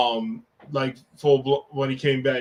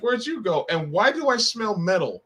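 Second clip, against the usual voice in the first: much higher, incredulous; mock alarmed question; 7 semitones higher than usual. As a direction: react to the bloody return. Imagined shock in the car.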